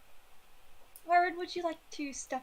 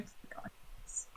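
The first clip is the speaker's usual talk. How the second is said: whisper; 14 dB quieter than usual. Acted. Unvoiced line among loud chat.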